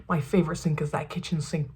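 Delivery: a half-embarrassed whispered admission; whispered confession. Real, not acted. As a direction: whisper it like a small secret. Confessing a favorite sink.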